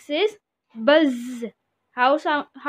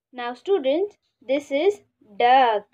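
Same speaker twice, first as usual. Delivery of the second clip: ordinary talk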